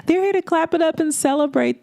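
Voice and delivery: high, bright call to the crowd; excited shout-out. Real, not acted. Host hyping her studio audience, excited shout. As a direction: hype the crowd up.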